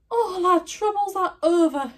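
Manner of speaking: high and loud delighted cry. A narrator's reading. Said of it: "she cried".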